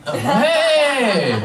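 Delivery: high, drawn-out greeting; calling out. Real, not acted. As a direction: sing out hello to someone across the room. Calling out to girlfriend in audience.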